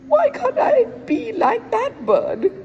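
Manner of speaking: high, loud crow voice; a crow longing for a peacock's tail; envious crow. Acted. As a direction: squawk the wish with envy. Crow's envious wish.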